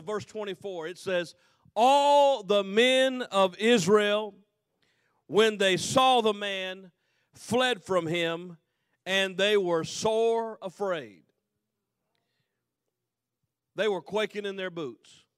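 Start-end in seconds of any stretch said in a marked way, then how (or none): 1.7-11.2 loud, slow, emphatic reading; booming scripture. Acted. Preacher reading the Bible aloud, loud and slow. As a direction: proclaim each phrase slowly to the congregation.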